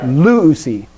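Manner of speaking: "Luusi" with LU emphasized, LU-u-si; teacher's model "Luusi", front-stressed; stress on first syllable. Shown as a demonstration. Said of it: "emphasize that first one".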